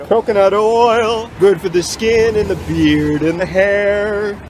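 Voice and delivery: loud, slow, high pitchman voice; excited ad read. Acted. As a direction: hype it like a commercial.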